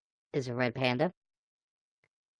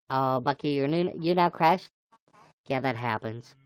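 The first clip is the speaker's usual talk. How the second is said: ordinary talk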